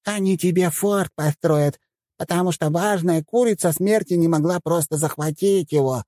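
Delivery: high squeaky voice mimicking a woman, slow; squeaky mocking voice. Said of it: "продолжил говорить писклявым голоском".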